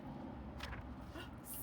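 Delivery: unvoiced alarmed gasp; a sudden startled breath; breathy shocked gasp. A narrator's reading. Bees let in.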